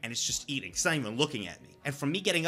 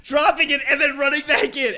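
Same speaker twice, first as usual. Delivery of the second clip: excited; 9 semitones higher, 9 dB louder than usual. Real, not acted. Excited retelling, laughing.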